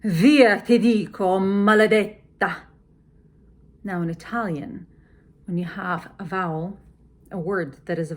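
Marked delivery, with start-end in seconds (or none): 0.0-2.6 loud, ringing order with a slow curse; frustrated command. Shown as a demonstration. Lady Macbeth furiously ordering the spot away. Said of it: "More frustrated".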